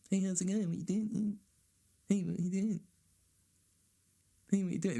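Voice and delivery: high whiny mock texter; mock needy texter. Comedian mocking friends who text him, high whiny voice. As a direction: ham up a clingy friend texting.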